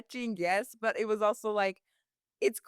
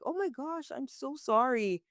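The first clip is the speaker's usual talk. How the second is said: sympathetic gasp; 7 semitones higher than usual. Retold or imitated. Comforting upset customer.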